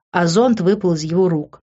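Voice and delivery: ordinary delivery, nothing marked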